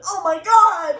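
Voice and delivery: high, loud mock shriek; freaking-out grandma. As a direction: imitate a grandma losing it. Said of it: "She, like, went fucking insane".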